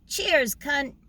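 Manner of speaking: put-on high, bright Australian accent; imitating an Australian toast. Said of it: "my Australian accent".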